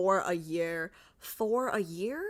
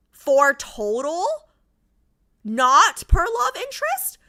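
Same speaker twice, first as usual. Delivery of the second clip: higher, louder, slow; exasperated outburst; 8 semitones higher, 10 dB louder, slower than usual. Real, not acted. Streamer arguing card schedule with chat, loud and high. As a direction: push back incredulously.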